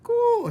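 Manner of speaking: very high, very loud squawk; shouted caw. Acted. Mig missing the mouse, squawking very high and loud. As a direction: blurt it like a crow. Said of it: "shouted Mig".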